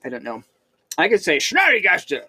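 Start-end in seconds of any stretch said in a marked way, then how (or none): 1.4-2.2 mock German accent on the name. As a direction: say it like a hammed-up German. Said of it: "maybe in a German voice".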